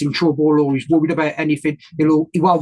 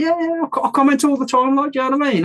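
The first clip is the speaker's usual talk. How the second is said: starstruck fanboy; 8 semitones higher than usual. Retold or imitated. Retold own starstruck reply.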